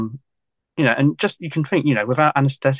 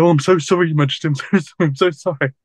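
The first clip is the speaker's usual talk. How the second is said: grovelling apology; 4 semitones higher than usual. Retold or imitated. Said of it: "He said".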